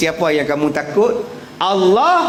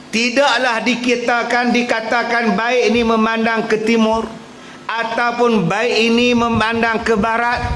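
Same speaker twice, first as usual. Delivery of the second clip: fiery preaching; 7 semitones higher than usual. Acted. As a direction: preach it hard to the congregation. Preacher expounding the verse.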